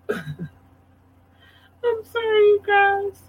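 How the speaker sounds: high, slow laughing apology. Real, not acted. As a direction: giggle helplessly through it. Laughing through hiccups.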